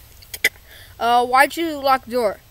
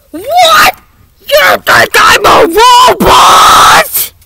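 much higher and louder indignant yell; outraged shout; 9 semitones higher, 12 dB louder, slower than usual. Acted. Mister Krabs outraged at being called a robot, loud shout. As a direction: explode at the accusation.

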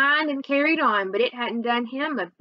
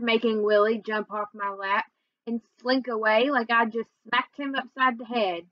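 ordinary delivery

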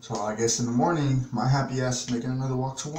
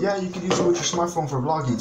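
higher, sugary clerk voice; imitating the Walmart lady's promise, pitched up; store clerk; 7 semitones higher than usual. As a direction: mimic the clerk sweetly reassuring. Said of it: "And she goes".

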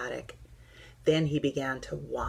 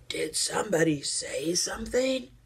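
put-on old teddy bear voice, puzzled; 3 semitones higher than usual. A narrator's reading. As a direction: speak up as a half-hidden old bear. Old Bear first speaks from boxes.

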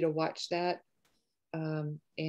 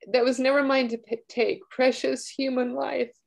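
choked-up reading; 7 semitones higher, 5 dB louder than usual. Real, not acted. Rereading prisoner's letter to the judge, voice breaking. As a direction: push the words out through tears. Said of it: "I didn't think I'd get emotional reading this".

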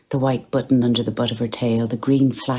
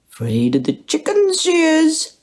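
put-on much higher teasing baby voice; mocking; 4 dB louder than usual. Bloom teasing his cat, high mocking baby talk. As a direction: tease her in cooing mockery. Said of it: "he said mockingly".

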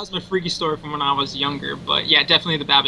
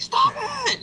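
put-on frightened kid pleading; 4 dB quieter than usual. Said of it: "And I'm like".

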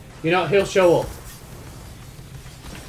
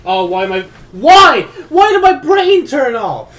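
self-directed rage; 11 semitones higher, 12 dB louder than usual. Real, not acted. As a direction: berate yourself at full volume. Raging at own mistake.

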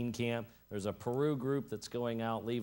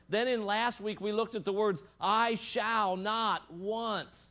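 impassioned preaching; 8 semitones higher, 4 dB louder than usual. Real, not acted. Preacher urging listeners to claim the psalm, impassioned and building. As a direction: build each repeated phrase toward a ringing quote.

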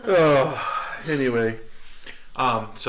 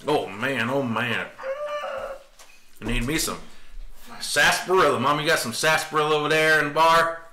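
put-on playful old-West cowboy talk; hammed-up cowboy chatting over grub; cowboy accent; 3 semitones higher than usual. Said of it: "go straight with the cowboy".

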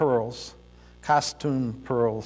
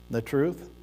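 ordinary talk

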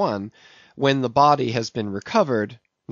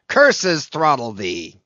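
louder, slow furious growl of a curse; yelled curse; 5 dB louder, slower than usual. A narrator's reading. Ahab yelling a curse back at Gabriel, louder and slow. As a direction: spit the curse back at him. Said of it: "yelled Ahab".

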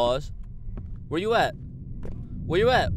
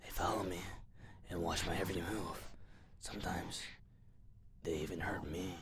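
softly voiced, eerie note; a hushed reading of a haunted note; whispered creepy reading; 10 semitones lower, 21 dB quieter than usual. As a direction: breathe the words like a secret.

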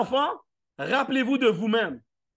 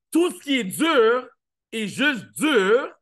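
slow, loud, every word pressed; emphatic slow point; 2 semitones higher, 5 dB louder, much slower than usual. Real, not acted. Speaker to audience, slow emphatic lesson. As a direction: land the line slowly and firmly. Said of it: "punchline slowed and loud".

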